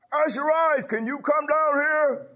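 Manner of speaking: high, slow calling voice. Retold or imitated. Charlene calling Usher Rice down to her, high and drawn out. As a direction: call him down from across the house.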